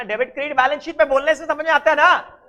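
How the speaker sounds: teacher checking the class understands; exasperated check. Real, not acted. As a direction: demand they admit they follow.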